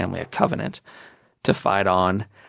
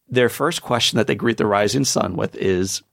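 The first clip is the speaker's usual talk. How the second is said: ordinary delivery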